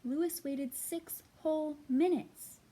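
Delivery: put-on lively read-aloud to children; storybook reading. A narrator's reading. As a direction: read it like story time for little kids. Reads a picture book aloud.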